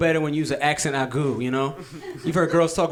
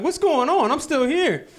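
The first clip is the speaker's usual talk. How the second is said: high squeaky protest; indignant sperm; 8 semitones higher, slower than usual. Pretend. Last sperm complaining to the new arrivals, high squeak. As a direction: play a tiny sperm left behind and offended.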